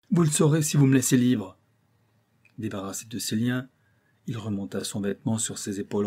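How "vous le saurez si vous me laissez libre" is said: raised, louder captive's plea; urgent bargaining. A narrator's reading. Captive gives in to captors.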